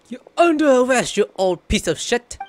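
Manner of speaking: loud gleeful taunt; mock arrest yell. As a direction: bark it like a cop, half-joking. Streamer mock-arresting villain.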